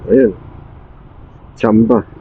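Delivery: ordinary talk, nothing marked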